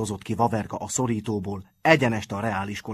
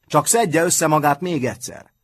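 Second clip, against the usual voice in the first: loud, raised challenge; defiant shout; 6 semitones higher, 7 dB louder than usual. A narrator's reading. Matthias daring his dazed opponent to recover, shouting. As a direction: call it out high and loud. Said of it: "kiáltotta Matthias".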